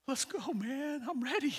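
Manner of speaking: soft, high, strained eagerness; hushed excitement. Retold or imitated. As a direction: squeeze out a small, overwhelmed cheer. Repeats reaction, as if overcome.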